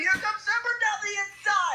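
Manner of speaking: rising with excitement; excited. Real, not acted. Last laps, rival closing.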